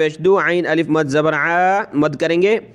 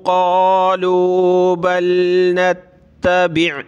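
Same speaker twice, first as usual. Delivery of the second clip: slow, drawn-out tilawat; Quran recitation; 2 semitones higher, much slower than usual. Shown as a demonstration. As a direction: recite the verse slowly with melody. Recites the full verse. (धीमी सुरीली तिलावत)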